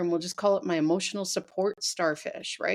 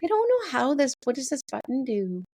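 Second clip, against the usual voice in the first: confused user; 5 semitones higher than usual. Retold or imitated. Acting out a confused new user.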